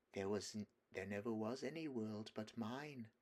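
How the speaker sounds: soft lulling witch. A narrator's reading. Witch lulls.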